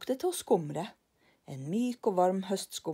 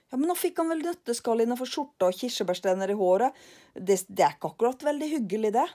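louder, hurried fretting; worried lillebror; 5 semitones higher, 6 dB louder, faster than usual. Acted. Lillebror worries to Karlsson, louder and hurried. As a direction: fret about the poor man.

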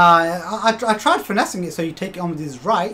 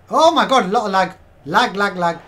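exasperated complaint; 4 semitones higher, 8 dB louder than usual. Real, not acted. Game lags.

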